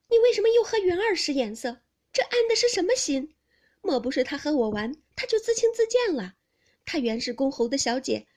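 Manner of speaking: loud, high, resentful accusation; angry interrogation. A narrator's reading. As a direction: demand an explanation, stung and indignant. 黛玉质问宝玉. (气愤地高声质问)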